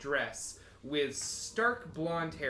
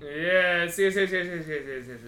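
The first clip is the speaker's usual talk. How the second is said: rapid yes chatter; 5 dB louder than usual. Acted. Character repeats yes rapidly.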